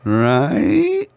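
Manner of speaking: high, squealed right; squeaky mock question. Real, not acted. Player teasing viewers over a risky play, squeaky and high. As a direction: tease it up in a squeaky voice.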